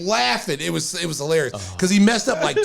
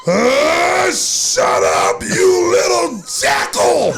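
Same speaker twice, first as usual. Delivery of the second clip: louder shouted shut up, you little jackal; mock angry yell; 8 semitones higher, 3 dB louder, much slower than usual. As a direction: bark it at the loudmouth in back. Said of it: "he turns, heckling scene".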